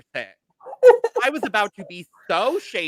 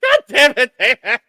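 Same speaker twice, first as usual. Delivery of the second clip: laughing exclamation; 4 semitones higher, 8 dB louder than usual. Real, not acted. Laughing at being teased.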